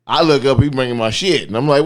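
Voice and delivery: usual speaking voice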